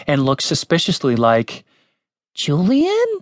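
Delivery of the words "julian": high, rising, incredulous question; startled question. A narrator's reading. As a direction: say the name in high-pitched disbelief. Tim recognises Julian in surprise.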